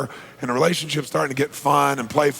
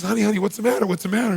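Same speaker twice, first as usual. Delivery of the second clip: higher-pitched, anxious coaxing; concerned father; 7 semitones higher, faster than usual. Retold or imitated. Father asks his crying daughter, acted concern. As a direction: play a dad fussing over his child.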